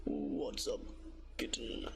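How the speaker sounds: very deep, low purr of a flirty man; daddy voice. Pretend. Streamer teasing chat in a deep daddy voice. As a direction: drop way down and croon it. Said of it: "I can do a daddy voice for you".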